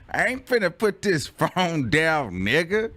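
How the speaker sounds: high, drawn-out retort through laughter; laughing defiance. Acted. Playing the phone holder, breaking up laughing. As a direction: crack up while refusing. Cracking up while acting refusal.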